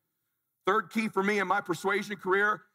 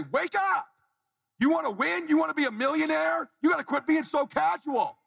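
raised, higher, urgent coaching call; wake-up call; 7 semitones higher than usual. Retold or imitated. Speaker calling the audience to stop being casual, higher and urgent. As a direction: shake a sleepy listener awake with higher, pushing urgency. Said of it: "I say".

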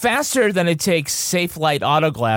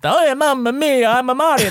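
mock Italian accent; 6 semitones higher than usual. Joking about a soon-problematic bit, hammed-up Italian accent. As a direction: play the cartoon Italian accent big. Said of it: "I keep saying that Italian accent".

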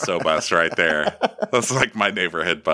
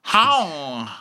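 imitating a desperate plea; 10 semitones higher, slower than usual. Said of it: "She's like".

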